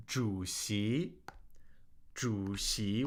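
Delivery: 主席 with a low 主, then rising 席; tone demonstration. Shown as a demonstration. Teacher introducing a list word to students, 主席 in careful third and second tones. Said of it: "Third tone, second tone".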